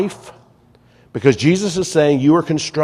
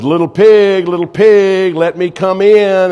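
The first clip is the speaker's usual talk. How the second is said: loud, higher storybook wolf call; big bad wolf; 6 semitones higher, 7 dB louder, slower than usual.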